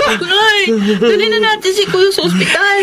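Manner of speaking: urgent, fast, close to tears; panicked plea. Acted. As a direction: beg her to hurry, voice cracking. Panicked plea to rush to hospital.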